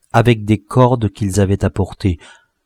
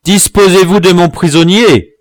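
indignant, high and loud demand; raised voice; 7 semitones higher, 5 dB louder than usual. A narrator's reading. Dwarf claiming his prisoner, raised voice. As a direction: challenge him loudly. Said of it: "en haussant la voix".

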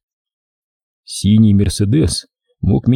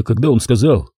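ordinary delivery

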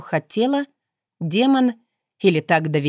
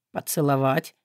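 low, soft hoarse question; husky stunned murmur; 4 semitones lower, 4 dB quieter than usual. A narrator's reading. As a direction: rasp it out, barely voiced. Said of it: "сипло вымолвила Кара".

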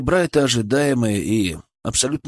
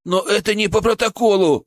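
high, agitated objection; panicked protest; 9 semitones higher than usual. A narrator's reading. Colleague objects to breaking protocol, panicked. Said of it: "запаниковал Петров".

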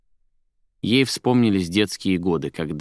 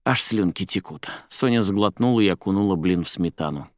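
usual speaking voice

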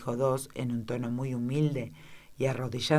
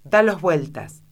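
louder angry command; very angry order; 3 semitones higher, 7 dB louder than usual. A narrator's reading. Queen to the jack, angry louder order. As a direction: bark it at the servant. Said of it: "enojadísima".

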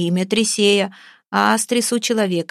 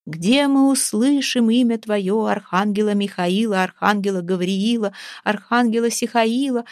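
plain reading voice

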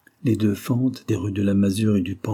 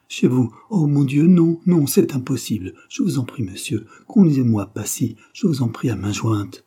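high, loud panicked begging; a woman pleading not to enter a stranger's house; frightened pleading; 4 semitones higher, 4 dB louder than usual. Acted. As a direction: beg him not to take you up. Girl refusing, pleading in fear.

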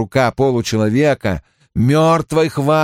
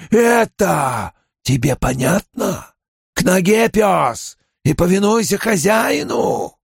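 angry commanding creature voice; dark lord; 7 semitones higher than usual. A narrator's reading. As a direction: snarl orders at a dog. Myrddraal furious at questions.

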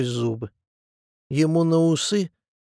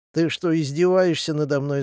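usual speaking voice

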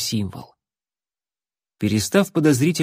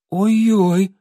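flustered; 8 semitones higher than usual. Acted. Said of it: "растерянно обронил Алан".

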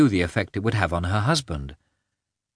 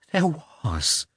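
high, trembling admission; tremulous, higher-pitched and drawn out; 7 semitones higher than usual. A narrator's reading. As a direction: admit it in a small, trembling voice. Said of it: "in a trembling voice".

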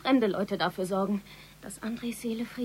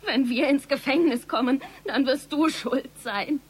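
angry accusation; 3 semitones higher, 7 dB louder than usual. Acted. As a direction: throw the blame at him. Heated family quarrel.

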